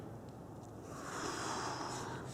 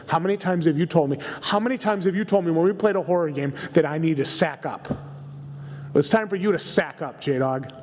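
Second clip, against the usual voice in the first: heated taunt; 7 semitones higher than usual. Acted. Lecturing scared partner.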